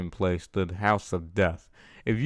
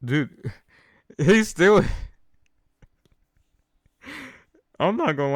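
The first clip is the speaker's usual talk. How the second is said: cracking up mid-sentence at the text; laughing; 9 semitones higher than usual. Real, not acted. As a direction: laugh it out, can't hold it in. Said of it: "I don't know why I'm laughing".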